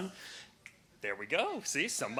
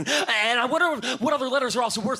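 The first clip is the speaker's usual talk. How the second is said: put-on higher, fast childlike rambling; excited kid monologue; distracted kid; 8 semitones higher, 7 dB louder, faster than usual. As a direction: let the thoughts tumble like a kid. Child's wandering thoughts voiced.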